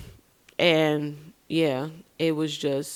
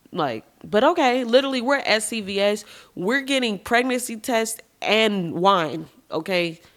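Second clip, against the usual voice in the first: normal talking voice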